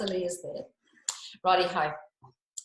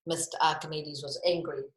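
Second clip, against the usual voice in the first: ordinary delivery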